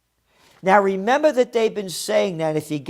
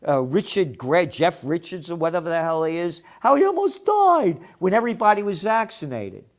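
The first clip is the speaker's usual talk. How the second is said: usual speaking voice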